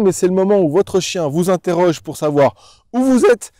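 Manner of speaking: ordinary talk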